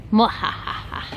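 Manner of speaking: evil cackle; mad scientist laugh. Pretend. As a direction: cackle like a villain locking someone in. Threatening to shut door on him.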